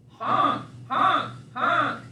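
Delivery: goose honk. Acted. Goose sounds.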